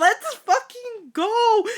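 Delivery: high, loud, drawn-out cheer; excited shout. Real, not acted. Co-host thrilled they will read the fanfic, excited shout. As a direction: whoop it out with delight.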